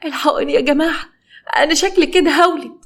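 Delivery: high-pitched plea, faking labour pains; feigned labour pain. Acted. Pregnant suspect faking labour at the police station, mock pained cry. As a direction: cry for help in a high voice, without shouting. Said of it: "بدأت تمثل إن هي بتتوجع وتصرخ".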